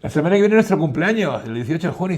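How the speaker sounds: playful Beatle voice, high and bright-eyed; imitating [name]. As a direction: photographer retelling a star's birthday invite, high and loud.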